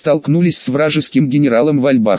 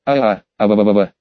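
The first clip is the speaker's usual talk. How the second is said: low wailing moan; 6 semitones lower than usual. Acted. Darkness moaning over lost crystals.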